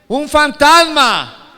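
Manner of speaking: loud, high, drawn-out mock cry of fear; grito de miedo. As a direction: cry out slowly like a frightened disciple, theatrically. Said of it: "dieron voces de miedo". (grito de miedo declamado)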